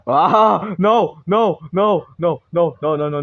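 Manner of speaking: high, loud repeated no in terror; terrified shrieks of no; panicked screaming. Real, not acted. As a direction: scream no as the monster grabs you. Jumpscare panic in horror game.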